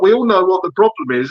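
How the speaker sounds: ordinary talk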